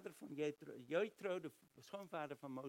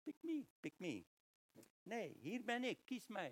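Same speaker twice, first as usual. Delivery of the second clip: higher, eager cartoon donkey voice; lightly done eager donkey from Shrek; 6 semitones higher than usual. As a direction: beg like an overexcited sidekick. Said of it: "Zoals in Shrek, waar die ezel zegt van".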